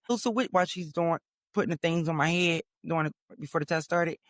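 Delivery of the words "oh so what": high, chirpy small-talk mimicry; mock the chatty lady in a higher voice; imitating chatty technician. Technician chatting while attaching the things, mimicked high. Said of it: "the bitch talking to me like".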